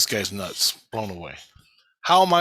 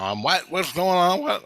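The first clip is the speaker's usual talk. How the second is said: retold angry confusion; 6 semitones higher than usual. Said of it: "I got pissed".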